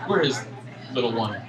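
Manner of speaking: ordinary talk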